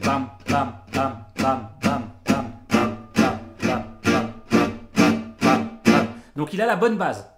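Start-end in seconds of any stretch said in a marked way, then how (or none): 0.0-6.1 loud unvoiced percussive bams, steady beat; rhythmic strumming bam. Shown as a demonstration. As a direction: punch each bam like a strum. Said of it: "Il a bien compris le truc".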